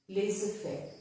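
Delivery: laissez-faire as "lay-suh-fair", no Z sound; silent Z. Shown as a demonstration. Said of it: "is silent".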